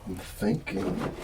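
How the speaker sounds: normal talking voice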